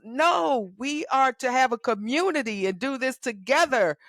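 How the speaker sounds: high, loud, fervent exhortation; rally them like a preacher; urging her listeners toward community in a high, loud preaching voice. Real, not acted.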